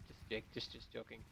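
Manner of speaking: breathy whispered take-back; whispering. Real, not acted. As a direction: whisper it sheepishly. Backs off a joke.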